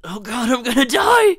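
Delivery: loud, high, terrified cry; panicked yell. Acted. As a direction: scream it in panic. Panicking over danger.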